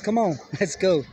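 normal talking voice

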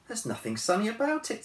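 put-on high, indignant young woman; a lightly voiced indignant girl; Anna's voice. A narrator's reading. Anna retorts to the bear.

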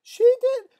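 high, loud falsetto yelp; panicked squeal. Acted. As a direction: squeal the accusation like a frightened kid. Girls squeal while fleeing.

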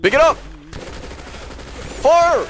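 high urgent shout; excited shouting. Real, not acted. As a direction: yell it as the trap springs. Springing a trap.

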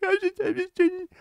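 high, put-on whiny streamer voice; mocking whiny streamer. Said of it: "when they're like".

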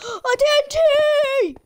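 gasp, then a high, loud drawn-out yell; real alarm, dragged out slowly; shocked cry. Real, not acted. Suddenly spots TNT in game.

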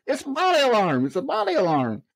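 high, insistent mock shout without the volume; retold urgent cry. Running lieutenant answering the officers about the alarm, urgent mock cry. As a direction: replay calling back urgently mid-run.

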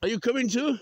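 high, loud coaxing question; calling out. Real, not acted. Walker invites the animals along, high loud call.

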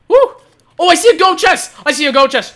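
high, loud burst of excitement; excited discovery. Real, not acted. Player spotting a gold chest, excited discovery. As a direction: cry out at sudden treasure.

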